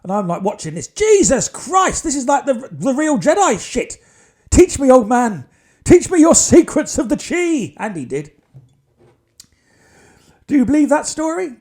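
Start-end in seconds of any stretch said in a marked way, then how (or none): 0.9-4.0 much higher, slow amazed exclamation. Real, not acted. Amazed watching healer. 4.5-7.7 higher, louder, slow pleading to a master; hammed-up eager apprentice begging; mock disciple.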